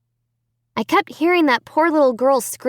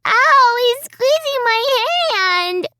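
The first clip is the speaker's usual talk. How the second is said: put-on child crying out in pain; 3 dB louder, slower than usual. A narrator's reading.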